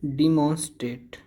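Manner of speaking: डिमॉन्स्ट्रेट opened with "dee"; wrong pronunciation. Shown as a demonstration. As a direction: say "dee-MON-strate" for डिमॉन्स्ट्रेट. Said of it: "ना डिमॉन्स्ट्रेट है".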